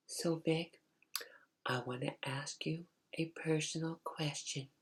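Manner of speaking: very soft, slightly high mom voice; gently pleading mother. Retold or imitated. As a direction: ask delicately, almost in a whisper. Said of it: "she was talking just like that".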